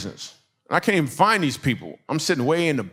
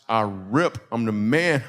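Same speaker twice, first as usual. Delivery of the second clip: normal talking voice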